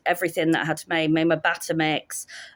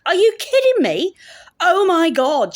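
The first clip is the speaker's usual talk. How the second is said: high, loud, incredulous mimicry; drunk Scots girl; 11 semitones higher, 6 dB louder than usual. Guest scoffing at the plastic gravy jug, loudly incredulous. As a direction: imitate an outraged, brash guest. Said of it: "she says".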